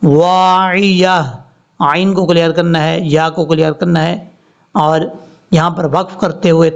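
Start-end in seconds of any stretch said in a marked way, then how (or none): 0.0-1.2 drawn-out chanted tajweed reading; slow Quran recitation. Shown as a demonstration. Teacher reciting verse slowly for students. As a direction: recite slowly, stretching each sound.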